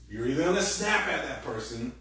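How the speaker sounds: ordinary delivery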